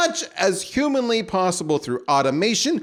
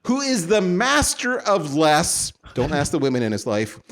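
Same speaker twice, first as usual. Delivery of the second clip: announcer intro; 5 semitones higher, slower than usual. Acted. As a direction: build the guest up like a ring announcer. Grand guest introduction.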